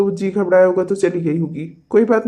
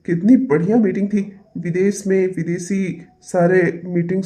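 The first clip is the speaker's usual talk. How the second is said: ordinary delivery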